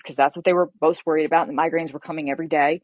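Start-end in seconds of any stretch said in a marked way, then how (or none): none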